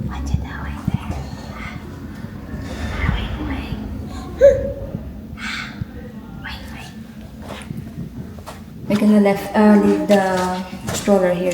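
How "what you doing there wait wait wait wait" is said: very soft, breath-only whisper. Real, not acted. Hushed talk in waiting area.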